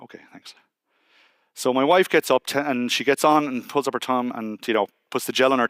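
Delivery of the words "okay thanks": breathy whispered okay thanks; whispered numb thanks. Retold or imitated. Husband thanking the midwife, numb whisper. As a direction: barely voice it, numb.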